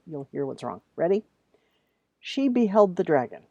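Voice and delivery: ordinary talk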